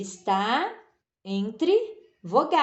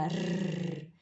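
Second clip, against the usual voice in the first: a quiet trilled rrr with the tongue; soft rolled r; 6 semitones lower, 8 dB quieter than usual. Shown as a demonstration. Teacher showing pupils the tongue trill, quietly. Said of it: "parece que faz assim".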